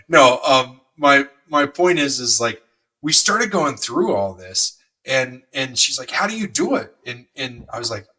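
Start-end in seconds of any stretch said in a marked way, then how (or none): 6.1-6.9 high-pitched quoted wonder; lightly voiced wife, marveling; imitating amazed wife. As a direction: ask it high, mildly amazed. Retells wife's amazed question.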